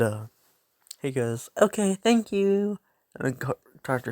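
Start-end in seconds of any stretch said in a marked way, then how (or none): 1.6-2.8 put-on higher, slow sweet kid voice; a light polite child. Izuku thanks the quirk doctor in a lightly voiced polite child voice.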